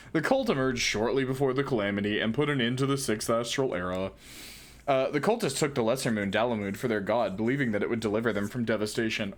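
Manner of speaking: lightly voiced dumb jock. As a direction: read it like a laid-back bro. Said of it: "a jock voice for all these bow guys".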